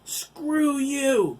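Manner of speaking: high furious shout; yelling. Acted. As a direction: scream defiance right up at the giant. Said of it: "Chris yelled".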